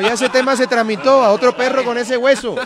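loud, high-pitched comic tirade; indignant scolding. Real, not acted. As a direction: scold the latecomer over the laughter. Scolds the latecomer for comic effect.

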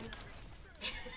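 soft voiceless laugh; breathy chuckle. Real, not acted. Streamer amused at being called Dragon, soft breathy chuckle.

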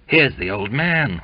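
usual speaking voice

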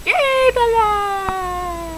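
high, very drawn-out yay; cheering. Pretend. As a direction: stretch out a squealed cheer. Cheering each puppy.

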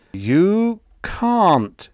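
spaced-out words, high and loud; very slow explaining. A narrator's reading. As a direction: explain very slowly, as to a small child. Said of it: "he said very slowly, as one would explain".

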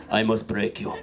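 put-on low, flat Eastern European accent; Dolph Lundgren. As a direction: imitate a flat Eastern European film villain. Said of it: "sounds like Dolph Lundgren from Rocky Four".

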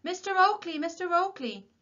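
high, loud calling shout. A narrator's reading. Old warden shouting after Tom outside the cottage, shouting. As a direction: hail him urgently from down the road. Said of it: "someone began shouting at them".

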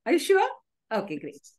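ordinary talk

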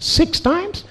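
very high squeak of disbelief; mock incredulous. Therapist hearing of six suicide attempts, squeaky mock disbelief. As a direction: squeal the question in put-on astonishment.

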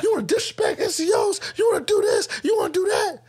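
high shouted drill-sergeant tirade; yelling down the whole platoon, furious; angry drill sergeant. Retold or imitated.

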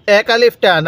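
ordinary talk, nothing marked

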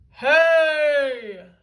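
loud startled shout. A narrator's reading. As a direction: shout at the giant in surprise. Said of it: "shouted Big Tippy".

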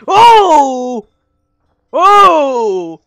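loud sudden gasp of shock; startled. Real, not acted. Player hearing the breathing, startled aloud. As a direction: jolt in fright.

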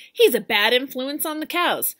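loud stern warning; loud stern farmer; ordering. Acted. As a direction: keep the order firm. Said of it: "ordered Farmer Brown".

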